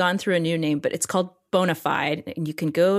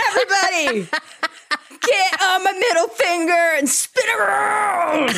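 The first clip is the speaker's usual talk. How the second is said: mock raging yell; 12 semitones higher than usual. Woman telling off everybody after pill plea, in mock rage. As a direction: holler it theatrically with laughter underneath. Acted comic outburst at everybody.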